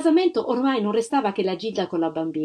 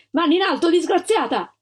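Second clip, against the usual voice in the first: much higher, loud threatening command; shouted command; 8 semitones higher, 3 dB louder than usual. A narrator's reading. Armed agents shout at the cornered mother, threatening. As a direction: bark at her to raise her hands.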